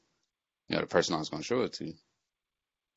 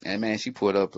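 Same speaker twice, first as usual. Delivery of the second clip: normal talking voice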